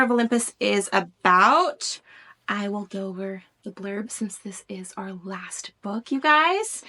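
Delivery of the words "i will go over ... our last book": whispered aside. Real, not acted. Hushed aside before blurb.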